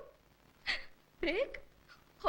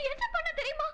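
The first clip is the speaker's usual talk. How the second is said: insistent repeat; 6 semitones higher than usual. Acted. Wife pressing her husband with the same question, higher.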